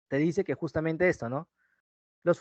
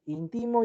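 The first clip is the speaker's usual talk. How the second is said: "íntimo" pronounced in-TI-mo, higher pitched; stress on middle syllable; 5 semitones higher, 3 dB quieter than usual. Shown as a demonstration. Teacher giving students another triple, "íntimo" stressed on TI as in-TI-mo. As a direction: shift the stress of "íntimo" onto TI. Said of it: "Cambia, igual".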